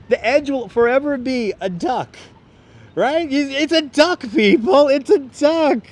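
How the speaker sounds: high, loud, gleeful insistence; excited insisting. Real, not acted. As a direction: sell the joke with rising glee. Insisting the building is a duck.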